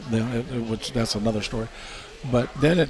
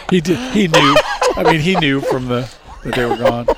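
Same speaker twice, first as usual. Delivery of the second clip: louder, still laughing through the words; 3 semitones higher, 8 dB louder than usual. Real, not acted. Explaining the joke to the hosts, laughing loudly.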